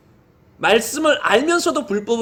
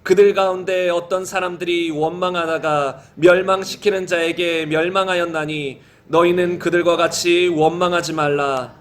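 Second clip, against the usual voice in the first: low, even, measured read-aloud; scripture reading; 5 semitones lower than usual. Real, not acted. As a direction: read the verses in a low, steady chant-like cadence. Reads 1 Corinthians passage aloud.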